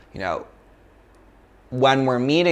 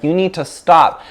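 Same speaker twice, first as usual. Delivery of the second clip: firm command; 6 semitones higher, 6 dB louder than usual. Retold or imitated.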